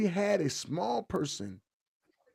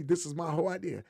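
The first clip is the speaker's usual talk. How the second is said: ordinary talk, nothing marked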